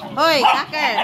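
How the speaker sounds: high, loud hey and name; calling out. Real, not acted. Owner calling her dog back, loud high shout. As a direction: yell to get his attention.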